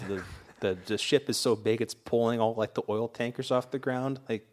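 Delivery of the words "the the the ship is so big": ordinary talk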